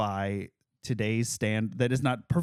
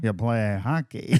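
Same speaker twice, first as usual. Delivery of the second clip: mock Brooklyn accent; 2 semitones lower than usual. Co-host joins the Brooklyn bit.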